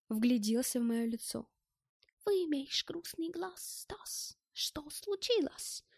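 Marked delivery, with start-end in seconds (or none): 2.3-5.8 slow, high, concerned German voice; caring, like a doctor. A narrator's reading. German studies Stas's sad face, caring slow voice. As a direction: enquire gently and slowly, trailing soft. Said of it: "как заботливый врач".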